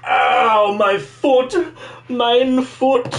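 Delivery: high-pitched yelp of pain; cry of pain. Real, not acted. Streamer hurting a foot off to fix the screen, pained yelp.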